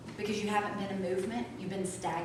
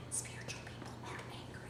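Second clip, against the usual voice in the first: whisper; 18 dB quieter than usual. Acted. Whispered aside of spiritual belief.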